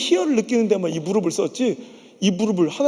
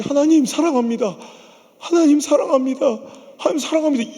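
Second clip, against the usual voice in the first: high, loud, repeated cry of love to God; crying out in prayer; 8 semitones higher, 4 dB louder than usual. Retold or imitated. Preacher reliving his prayer at the pulpit, loud and high. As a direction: pour it out to God, rising each time. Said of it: "이 고백만 나오는 거야".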